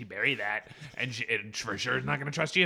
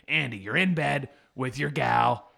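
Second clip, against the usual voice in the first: ordinary delivery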